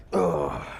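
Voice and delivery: effort grunt. Real, not acted. Grunting under a heavy lift.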